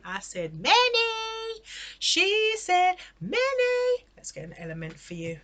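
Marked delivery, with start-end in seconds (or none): 0.0-1.6 many drawn out high and slow; stress on many. Shown as a demonstration. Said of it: "I didn't say one". 2.0-4.0 many drawn out high and slow; stress on many. Shown as a demonstration. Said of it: "I didn't say one".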